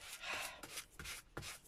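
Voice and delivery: long unvoiced sigh. Real, not acted. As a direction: let out a weary breath. After recalling a fall.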